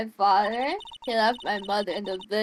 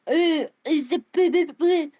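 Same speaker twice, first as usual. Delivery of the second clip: searching for a French accent, high and loud; trying out a French accent and stalling; hesitant stab at a French accent; fumbling French accent attempt; 6 semitones higher, 4 dB louder than usual. Shown as a demonstration. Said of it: "How do French people talk?".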